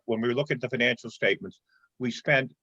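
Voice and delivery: usual speaking voice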